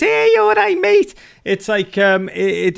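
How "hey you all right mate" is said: short Scouse greeting, much higher and louder; a broad Scouse "you all right, mate", loud and high; Scouse accent. Shown as a demonstration. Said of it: "it's like complete".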